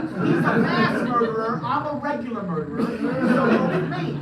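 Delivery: laughing at the absurd excuse; laughing mock boast. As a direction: play the absurd boast while cracking up. Mock politician argument, laughing.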